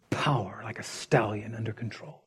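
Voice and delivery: soft, intense whisper. Real, not acted. Preacher to congregation, whispering the meaning of meek. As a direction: whisper it as a secret truth. Defining meekness at sermon's close.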